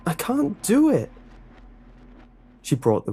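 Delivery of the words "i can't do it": shrieked very high and loud; terrified shriek. A narrator's reading. Tied-up boy shrieking at the lunch lady in terror. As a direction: break into a panicked shriek.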